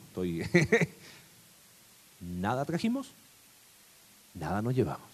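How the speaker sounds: ordinary delivery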